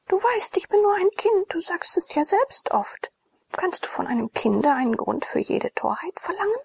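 a hint of a sulky child, whispered; young girl's voice. A narrator's reading. Blushing girl deflects Glaukus's question, hushed and girlish.

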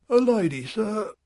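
high, blank butler voice; a servant announcing with a blank face; Hawken's high voice. A narrator's reading. Butler to his master, high and blank.

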